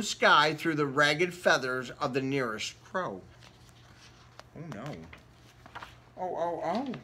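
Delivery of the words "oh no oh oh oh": hushed dread. Acted. Crow catching up.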